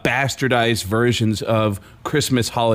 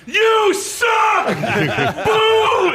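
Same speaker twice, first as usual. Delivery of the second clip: very high shouted jeer; stadium booing; much slower than usual. Retold or imitated. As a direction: yell it down from the upper deck. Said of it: "the upper level of the stadium were going".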